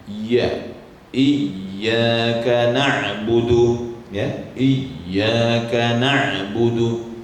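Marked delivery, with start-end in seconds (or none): 1.2-4.0 slow Iyyaka with the ya raised to the palate; very slow careful Iyyaka na'budu; clear ya sound. Shown as a demonstration. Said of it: "huruf iya itu tempatnya". 4.5-7.2 slow Iyyaka with the ya raised to the palate; very slow careful Iyyaka na'budu; clear ya sound. Shown as a demonstration. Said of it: "huruf iya itu tempatnya".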